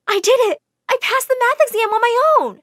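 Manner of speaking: loud, high-pitched triumph; elated shout. Acted. Girl running to hug her tutor after passing, overjoyed. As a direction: burst out with the great news.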